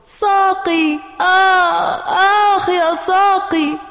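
put-on high, wailing child's cry; acted agonised wail; screaming in pain. A narrator's reading. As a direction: cry out over a hurt leg. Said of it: "صرخت جود بأعلى صوتها".

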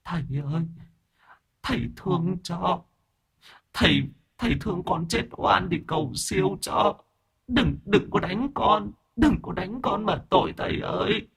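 high, soft tearful plea; whimpering ghost girl. A narrator's reading. Ghost girl pleading not to be beaten, tearful and high. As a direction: beg with a trembling ghostly whimper. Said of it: "ma nữ mếu máo".

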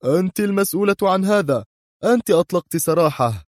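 high, stern accusation; Mr X stern anger. Acted. Mr X sternly blames Sonia for the escape, high. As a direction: lay the blame squarely on her. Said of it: "قال بصرامة غاضبة".

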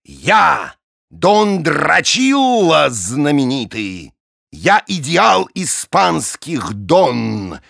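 slow, rising to a loud shout; brag, building to a yell; boastful don voice. Acted. Drachila tells bullfight tale.

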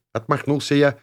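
ordinary delivery, nothing marked

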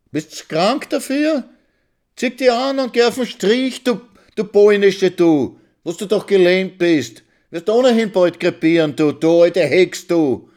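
very high, loud shrill abuse; wife cursing the lame old woman; screaming insults. Acted. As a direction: scream her down.

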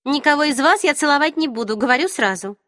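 high, firm declaration; firm young woman. A narrator's reading. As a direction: state it flatly to all. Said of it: "заявила".

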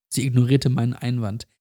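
ordinary delivery, nothing marked

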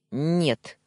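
loud, curt barked refusal. A narrator's reading. Husband cutting off his wife's request, barking. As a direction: bark it out, cutting her off. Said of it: "рявкнул я".

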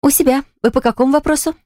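high, sugary receptionist voice; sweet little voice. Acted. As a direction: chirp it sweetly and politely. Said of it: "умею приветливо улыбаться и говорить милым голоском".

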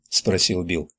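usual speaking voice

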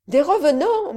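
high, loud disbelieving rise; astonished cry. Acted. Count hearing of ghosts, crying out in surprise. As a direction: shoot up in amazement. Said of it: "m'écriai-je".

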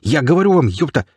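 high-pitched, insistent protest; worked-up, pleading accuser; insistent marauder. A narrator's reading. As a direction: keep pressing in a high voice. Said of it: "не унимался мародёр".

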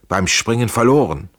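plain reading voice

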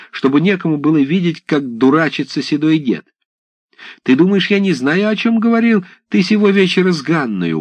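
высокий, медленный, обличающий голос; ревнивая баба отчитывает деда; гневная свояченица. Acted. (обиженная свояченица гневно отчитывает голову)

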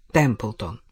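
plain reading voice

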